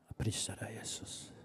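unvoiced whispered praise; whispered prayer. Real, not acted. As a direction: barely breathe the words.

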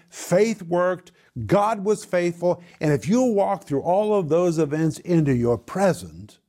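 rally the congregation, building fervor; full-force preaching. Acted. Preacher rouses his congregation about God's faithfulness, fervent and loud.

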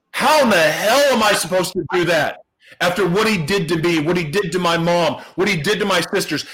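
ordinary delivery

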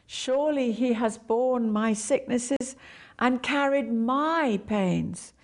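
my pains said slowly; slow, weight on my; stress on my. Shown as a demonstration. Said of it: "emphasize one word at a time".

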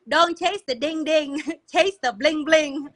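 high, loud playful Ling Ling voice with laughing; bubbly cartoonish Ling Ling popping in with a squeal; Ling Ling character voice. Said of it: "she's like".

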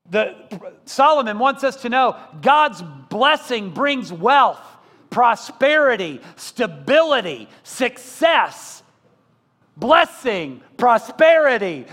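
full-force revival preaching. Acted. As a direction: preach it hard to the congregation.

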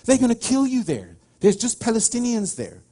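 high, urgent mock warning; alarmed warning. Israeli warns traveler off Jericho, alarmed. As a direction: imitate someone aghast at the travel plan. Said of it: "and the Israeli said".

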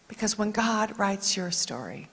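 usual speaking voice

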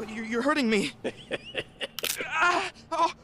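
high, anguished, pained cries; crying out in pain. Acted. Young visitor to the prisoner crushing his hand, in agony. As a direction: cry out as your wrist gives way.

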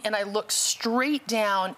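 ordinary talk

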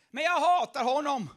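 loud burst of hatred; angry outburst. Acted. Ahab telling Josafat he hates Mika, loud and angry. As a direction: spit it out, loud.

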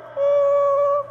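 high drawn-out bored ooh, mocking the congregation; bored churchgoer. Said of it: "we're like".

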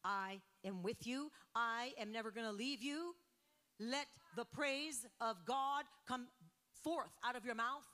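full-force preaching. Acted. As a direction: preach it out to the whole congregation. Opens sermon at full force.

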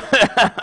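short chuckle breaking into speech; real laugh. Real, not acted. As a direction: crack up mid-sentence. Cracks up mid-sentence.

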